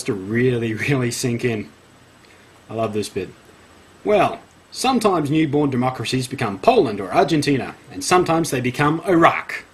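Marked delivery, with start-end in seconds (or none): 4.0-9.7 hammed-up emphasis on key words; theatrical read-aloud; dramatic article reading. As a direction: read it with mock gravity, punching the last word.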